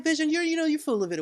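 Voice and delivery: high mock-wife dismissal; Wanda. Wanda dismissing her husband's suspicion, high imitation. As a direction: mimic a wife waving him off, pitched high. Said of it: "she was just like".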